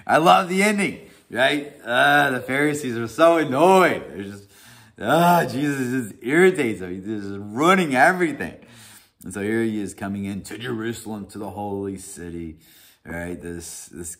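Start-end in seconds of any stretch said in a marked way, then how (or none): 0.0-8.6 much higher and louder, bursting with delight; excited. Real, not acted. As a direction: gush about the story's ending. Gushing about the ending.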